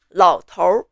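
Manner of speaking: 老头儿 with the final R curled on; Putonghua R sound. Shown as a demonstration. Teacher showing Mandarin learners the mainland word, R sound on the end. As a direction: end 老头儿 on an R-coloured vowel. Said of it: "The biggest difference resides with the R化音".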